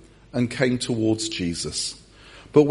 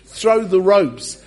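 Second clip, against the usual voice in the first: raised, high rescue call; mock urgent shout; 5 semitones higher, 3 dB louder than usual. Jesus to disciples in the boat, mock urgent shouting. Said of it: "he shouts to the disciples in the boat".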